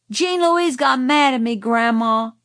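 put-on high, loud tattling child; calling out. A narrator's reading. Boy calling to Grandma to tell on cousin, calling out. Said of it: "called Francis".

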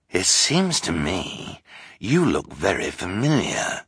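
slow, suspicious villain voice; sly villain. Acted. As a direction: drawl it with sly menace. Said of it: "he said".

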